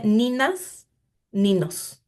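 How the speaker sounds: "ninas, ninos" with a plain N sound in place of ñ; ñ read as N. Shown as a demonstration. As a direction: read "ninas, ninos" literally, no tilde, just N. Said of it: "la ñ se vuelve N".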